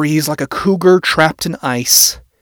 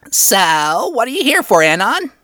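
high, curious employee voice; high-pitched imp; 8 semitones higher than usual. A narrator's reading. Male employee asking why Anon came, high-pitched. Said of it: "voice scratchy and high-pitched".

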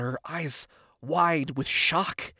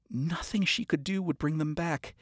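usual speaking voice

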